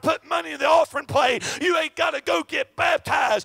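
loud, fervent, shouted sermon cadence; full-force preaching. Acted. Preacher calling the congregation to faith, shouting fervently. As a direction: hammer each line home to the congregation.

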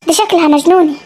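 a weary granny lamenting her marriage; old grandmother. Acted.

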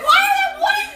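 shrieking disbelief. Real, not acted. Shocked pregnancy reveal reaction.

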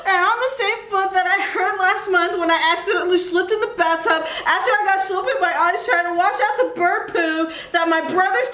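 put-on upset boy listing his troubles to the monster; crying kid rant. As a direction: pour it all out in one teary run-on. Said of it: "Then why are you crying?".

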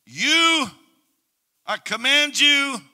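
loud, high command to the servants; commanding father. Acted. Father ordering servants, loud and commanding. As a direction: order it with full authority. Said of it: "he said".

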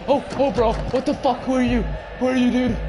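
high, loud alarmed yell; real jolt of panic, voice way up; startled shouting. Real, not acted. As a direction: demand it in a fright. Startled by a stranger in game.

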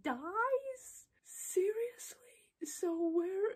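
incredulous. Real, not acted. Outraged disbelief at death.

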